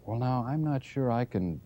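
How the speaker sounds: mild stammer. Acted. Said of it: "he stammered".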